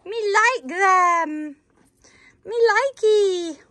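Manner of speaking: put-on high, slowed baby-talk for the pony; childish pony voice, lightly put on; voicing the pony. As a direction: give the horse a cute childish voice. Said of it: "He's like".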